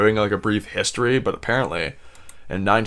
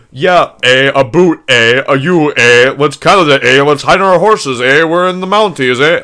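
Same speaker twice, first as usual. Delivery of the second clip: loud, hammed-up Canadian caricature, pitched up; mocking fake Canadian accent; 6 semitones higher, 10 dB louder than usual. Host mocking Americans who imitate Canadians, exaggerated Canadian accent. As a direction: blare a cartoon Canadian accent, every phrase capped with "eh". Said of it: "I fucking just hate when Americans do that".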